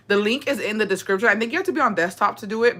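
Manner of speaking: ordinary delivery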